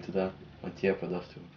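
normal talking voice

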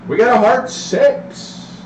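high, slow drawn-out dice call; craps table caller. Real, not acted. Dealer calls the roll.

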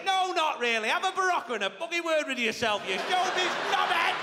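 slap him down with scorn; scornful brother. Retold or imitated. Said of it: "him seething".

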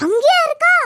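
loud, high-pitched startled question; shocked disbelief. Acted. Villager hearing where the old woman lives, shocked. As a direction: blurt it out in alarmed surprise. Hears of the hut at feared tree.

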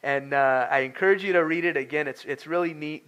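ordinary talk

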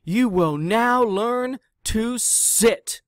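the trainer roaring orders at the class; roared command. Acted. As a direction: order them to sit, booming. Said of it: "roared the trainer".

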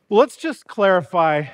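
normal talking voice

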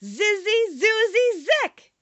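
loud, high, rising spell chant; magic incantation. A narrator's reading. Dorothy recites the magic charm.

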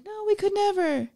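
high-pitched acted reassurance; mock protest. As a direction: protest sweetly as the characters. Characters protesting to the skull.

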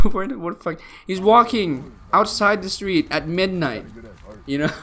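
ordinary talk, nothing marked

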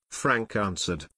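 ordinary delivery, nothing marked

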